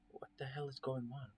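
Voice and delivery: hushed frightened whisper. Acted. Narrator whispering to Carl in the dark, hushed. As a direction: whisper it so no one hears.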